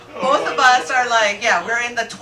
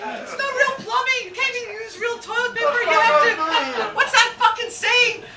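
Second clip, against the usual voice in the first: exasperated rant; 12 semitones higher than usual. Real, not acted. Cottage rant continues.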